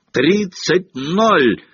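high, drawn-out commentator's yell; jubilant, stretched score call. A narrator's reading. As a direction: cry out the goal in triumph. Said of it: "завопил Джордан".

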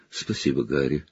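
low, soft gratitude; freed teacher thanking the boy softly; quiet thanks. Acted. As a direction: thank him gently.

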